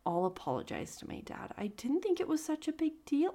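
soft, whispered regretful read. Shown as a demonstration. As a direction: try to sound sorry, hushed. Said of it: "I don't know how to read that in a way that's regretful".

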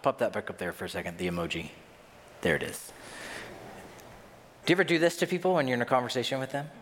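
ordinary talk, nothing marked

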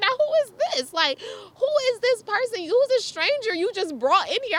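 high, loud mock indignation; indignant questioning. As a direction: imitate an outraged boyfriend demanding answers. Livid boyfriend questions the stranger.

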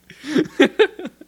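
high, loud real cackle; short, high peal of laughter; loud burst of laughter. Real, not acted. Cracking up at listener replies.

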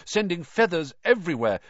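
usual speaking voice

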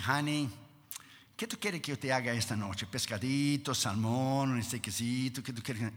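low, soft imitation of a sweet-talking wife; wheedling softly for a favor; coaxing wife. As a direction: coax gently, hushed and low. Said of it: "Damaris tiene tres maneras de hablar".

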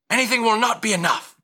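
high, loud, rapid rebuff; harsh refusal. Acted. Patron rebuffing a begging mother, harsh. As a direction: cut the pleading down fast and forcefully.

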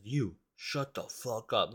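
slow, drawn-out mock threat; menacing retold order, stretched out slowly; intimidating. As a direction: imitate someone trying to intimidate, word by word. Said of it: "like, trying to intimidate us".

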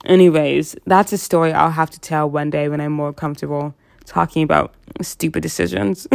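ordinary talk

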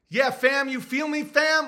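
high, punchy imitation of street slang; mock neighborhood tough talk. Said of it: "when you start talking that way".

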